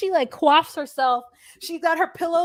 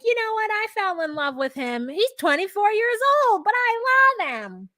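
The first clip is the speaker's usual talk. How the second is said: higher, gushing mock-mother voice; imitating Debbie; 8 semitones higher than usual. Cohost retells Debbie swooning over her young fiancé, higher gushing mimicry. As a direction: coo it like a smitten older woman. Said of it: "Oh, I love the way she says that".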